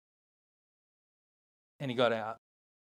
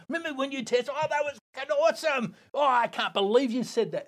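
much higher, giddy mock reunion; excited reminiscing; 11 semitones higher than usual. The surfer imagining laughing with Eddie over beers, much higher. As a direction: whoop it up like old mates over beers.

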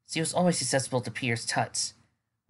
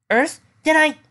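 high, loud shocked outburst; blurted out; 10 dB louder than usual. Acted. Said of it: "Twilight blurted out".

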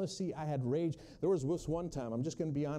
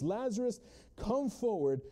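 raised, ringing cry from the tomb; loud cry; 6 semitones higher than usual. Acted. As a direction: cry out to the dead man. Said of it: "he cried out in a loud voice".

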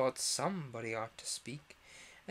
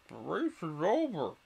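sudden raised dodo call; 4 semitones higher, 5 dB louder than usual. A narrator's reading. Dodo halting the runners, calling out suddenly. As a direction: call it out loud and high in character. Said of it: "the dodo suddenly called out".

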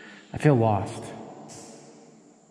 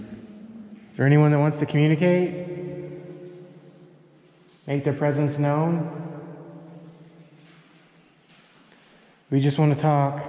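raised, gentle spirit-box call; 3 semitones higher than usual. Real, not acted. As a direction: coax the ghosts to talk. Calling out to spirits.

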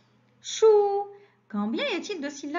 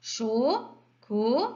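"Chocolat" slow, cho... co... lat; syllable by syllable; slower than usual. Shown as a demonstration. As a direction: separate each syllable of "Chocolat". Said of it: "la deuxième ou bien la dernière". (« Chocolat » lentement, syllabe par syllabe)

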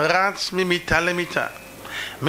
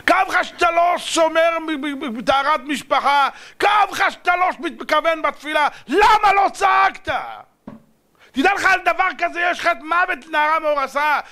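high, shouted accusing questions; impassioned shouting rebuke; thundering rebuke; 9 semitones higher than usual. Acted. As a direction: build into a full-force preacher's reproach. God rebukes: why did you not cry.

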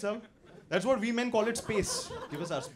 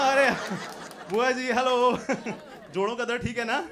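louder, higher hello to an aunt; raised-voice acted greeting; calling out a greeting; 4 semitones higher, 5 dB louder than usual. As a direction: call cheerily to a relative at a distance. Spots aunt and calls out greeting.

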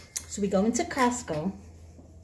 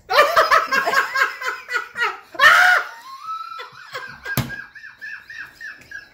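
howling laughter; 4 dB louder than usual. Real, not acted. Helpless laughter at the story.

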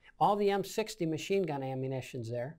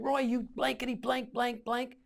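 higher-pitched retold cussing-out; agitated scolding, not shouted; incredulous cursing; 6 semitones higher than usual. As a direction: imitate a comrade swearing as if at a madman. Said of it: "like I'm crazy".

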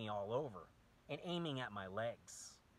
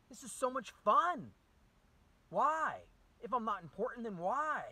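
much higher, louder sarcastic cry; bitter sarcasm; 13 semitones higher, 10 dB louder than usual. Real, not acted. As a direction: squeal it out, mocking and exasperated. Sarcastic rant about being attacked.

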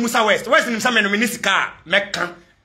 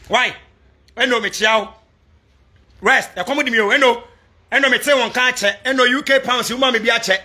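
usual speaking voice

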